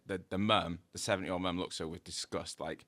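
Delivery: ordinary delivery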